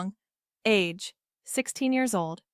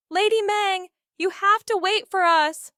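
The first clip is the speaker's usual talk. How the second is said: yelling; 9 semitones higher than usual. Acted. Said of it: "Xiaoya yelled".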